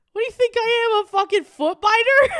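loud, high, offended shout; indignant yell. Retold or imitated. Coworker yelling at the mishearer, offended and loud. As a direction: yell back at the coworker, insulted. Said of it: "And she goes".

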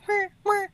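high-pitched imitated alarm chirp; two high mimicked beeps; smoke alarm beep. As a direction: imitate a weird electronic beep. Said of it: "Like, it would go".

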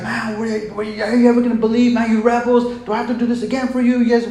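high, loud, exasperated rant; acted-out outburst at a stubborn crowd; angry yelling. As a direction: hammer them with a fed-up yell. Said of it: "yell at these guys".

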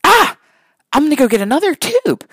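high, bright-idea exclamation, imitating a child; daughter's sudden idea. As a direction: pipe up like a girl who just solved it. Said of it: "she goes".

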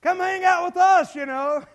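high, loud casual invitation; fake friend. False friend inviting a teen along, mock-chummy. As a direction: play the pushy would-be pal. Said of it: "that's gonna be your friend".

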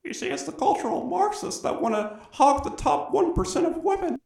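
very high squeaky lecturing parody voice; mock J B P voice. Podcast host parodying a pundit on cultural Marxists: squeaky high mock voice. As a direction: ham up the pundit, pitch way up. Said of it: "the J B P voice".

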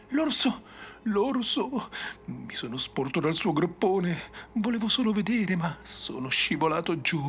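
high, panicked torrent of words; frantic raving. Acted. Maddened survivor blurts his terror to the ranger, high and frantic. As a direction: babble it out in terror, pitched high. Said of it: "gli vomitò in faccia un fiume di parole".

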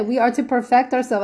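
normal talking voice

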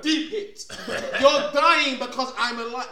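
laughing taunt. Retold or imitated. Acting out villain taunt, laughing.

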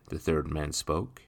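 ordinary delivery

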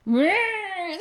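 high, rising car-engine noise; imitated engine revving. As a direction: imitate a car straining uphill. Imitates the car straining uphill. (imitando o ronco do motor)

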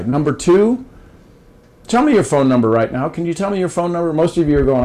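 ordinary delivery

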